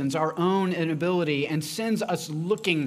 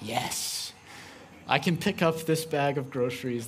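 mock macho dad; 6 semitones lower, 5 dB quieter than usual. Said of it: "And I'm like".

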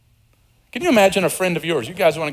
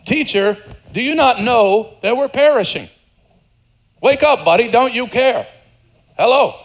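higher, louder exasperated rebuke; 7 semitones higher, 4 dB louder than usual. Retold or imitated. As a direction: scold him like a friend who sleeps through danger. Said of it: "it's a rebuke".

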